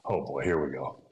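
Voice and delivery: resigned whisper. Retold or imitated. Hearing the country song in his truck, resigned whisper. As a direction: mutter it, bracing yourself.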